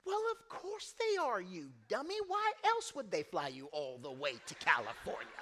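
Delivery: high, loud knowing scold; mock wife calling him a dummy; teasing wife. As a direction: tease him like an exasperated wife.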